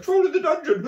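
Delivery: high, hammed-up alarm cry; imitating a terrified announcement; panicked film shout. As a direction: shout a mock warning, laughter creeping in. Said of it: "and Crow's like".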